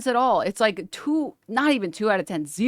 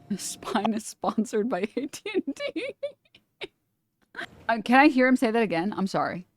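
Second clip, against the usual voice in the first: ordinary talk, nothing marked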